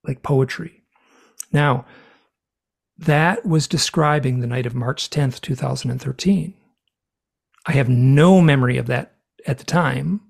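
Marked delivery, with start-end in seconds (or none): none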